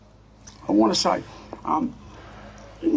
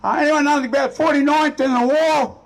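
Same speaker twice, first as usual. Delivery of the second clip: raised, high-pitched shout across a room; calling out; 8 semitones higher than usual. Retold or imitated. Young soldier asks a club full of veterans, calling out. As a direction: holler it out to a crowd.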